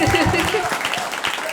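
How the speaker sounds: high, loud burst of happy laughter; joyful laugh. Real, not acted. Laughing with joy before the congregation, loud. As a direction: laugh out in delight.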